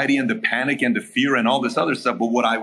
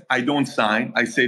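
normal talking voice

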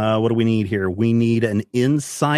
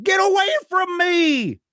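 high, frantic cry to back off; panicked shout. Acted. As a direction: yell it in terror. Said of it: "He's like".

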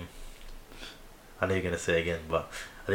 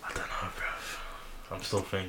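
slow, hushed, unsure reply; hesitant near-whisper; 10 dB quieter than usual. Real, not acted. Friend unsure about buying a new Switch, hesitant whisper. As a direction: breathe it out quietly while still deciding.

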